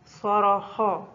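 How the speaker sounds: صرخا said very slowly, each sound clear; very slow classroom model of صرخا; slow example word. Shown as a demonstration. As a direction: draw out صرخا for the pupils. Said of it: "في آخر الكلمة".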